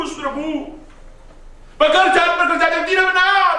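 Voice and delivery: high, loud fervent preaching; preaching at full force. Acted. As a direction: build it up from the pulpit.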